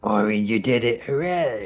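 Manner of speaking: usual speaking voice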